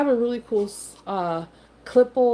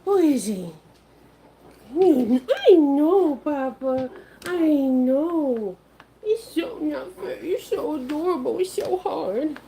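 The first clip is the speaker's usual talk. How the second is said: puppy voice; 4 semitones higher, slower than usual. Real, not acted. Baby talk to pets.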